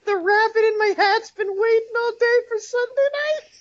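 shrieking helium squeak; cartoon chipmunk voice, laughing. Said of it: "He's been in helium voice".

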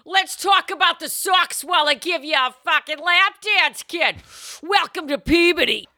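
put-on brash Boston accent; Boston stripper. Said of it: "Boston accent".

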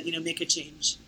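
normal talking voice

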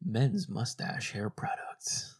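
radio ad voice. Acted. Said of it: "the radio voice you made".

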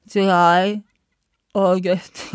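talking with the tongue out. Shown as a demonstration. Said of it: "put your tongue out on your lower lip".

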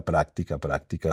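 fading repeats. Shown as a demonstration. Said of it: "repeated práctica fading".

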